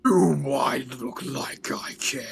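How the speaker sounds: whispered demonic sneer. Acted. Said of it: "speak in my demonic voice".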